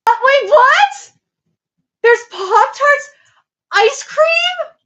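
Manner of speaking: high, loud and slow, stunned by the news; can't believe there's ice cream; surprised disbelief. Real, not acted. To chat, hearing there's Pop-Tarts, stunned and excited.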